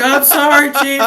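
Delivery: high, loud blurted apology; hammed-up frantic recruit shout; mock panicked apology. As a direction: yelp it like a terrified recruit.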